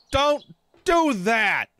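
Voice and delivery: loud high exasperated yelling; angry yelling. Real, not acted. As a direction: shout at a reckless teammate. Teammate jumps off a tower.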